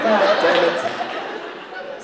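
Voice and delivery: loud exasperated curse under the breath; frustrated god damn. Retold or imitated. Trying to see the girl, loud frustrated curse. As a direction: blurt it out, frustrated.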